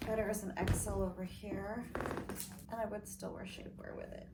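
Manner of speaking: usual speaking voice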